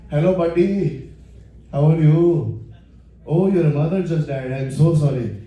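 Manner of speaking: Indian convenience store owner, insincere sympathy; sarcastic Indian accent. Acted. Store owner consoling a customer, sarcastic Indian accent. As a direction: do a sing-song, sarcastic-sounding Indian accent. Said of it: "it's a really specific Indian accent".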